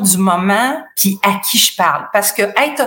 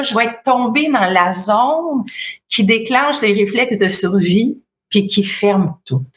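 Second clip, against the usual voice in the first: ordinary talk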